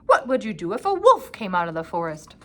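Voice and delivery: loud stern scolding; scolding grandfather. A narrator's reading. Grandfather scolding Peter about the meadow, stern and loud. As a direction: scold the boy firmly. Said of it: "he scolded".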